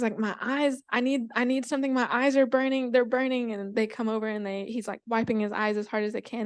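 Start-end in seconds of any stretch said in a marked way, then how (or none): 0.2-3.5 higher, urgent pleading; pained owl. Acted. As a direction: beg for help with stinging eyes, lightly acted. Owl with burning eyes pleads.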